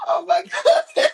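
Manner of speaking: cracking up in disbelief; laughing disbelief. Real, not acted. Guest laughing at being called out, loud and high.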